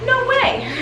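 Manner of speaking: ordinary talk, nothing marked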